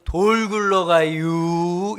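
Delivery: put-on very slow, drawn-out Chungcheong accent; 느릿한 충청도 사투리. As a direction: stretch it lazily in a Chungcheong accent. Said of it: "충청도 사람이 뭐라고 얘기했죠".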